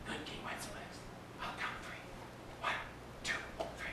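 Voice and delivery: soft unvoiced whisper, barely there; nervous whispered question in the dark; frightened whisper. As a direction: breathe it so nobody inside hears. Boys counting in before carol.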